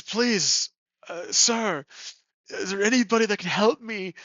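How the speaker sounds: put-on high-pitched, imploring old man; pleading. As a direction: appeal urgently to a stranger for help.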